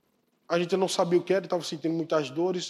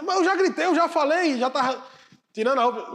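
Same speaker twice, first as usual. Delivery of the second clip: high, loud, half-laughing complaint; exasperated protest; 8 semitones higher, 5 dB louder than usual. Acted. Man protesting to the Holy Spirit, loud exasperated complaint. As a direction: protest that you already did it.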